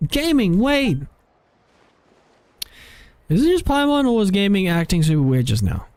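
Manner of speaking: high cartoon companion, puzzled; Paimon calling out. Voices Paimon calling after Gaming.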